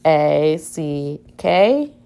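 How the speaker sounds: letters A C K spaced out slowly; slow spelling. Shown as a demonstration. Teacher spelling slowly for the class to write. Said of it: "spelling out jacket".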